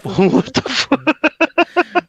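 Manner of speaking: drawn-out, high what the fuck; slow incredulous what the fuck; stunned disbelief. Real, not acted. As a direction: stretch it out in pure disbelief. Stunned reaction to odd line.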